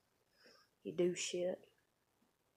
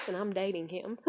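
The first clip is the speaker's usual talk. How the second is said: normal talking voice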